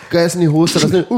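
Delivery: ordinary talk, nothing marked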